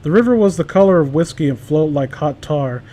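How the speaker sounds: normal talking voice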